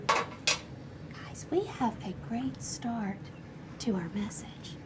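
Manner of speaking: slow, hushed whisper. Real, not acted. Teacher praises the class's great start, whispering. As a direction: whisper it slowly to the group.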